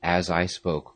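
plain reading voice

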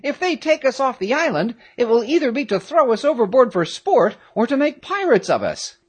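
put-on very high-pitched, anxious boy character; high, worried character voice; Peterkin's alarmed voice. A narrator's reading. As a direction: fret aloud in a high voice. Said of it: "cried Peterkin".

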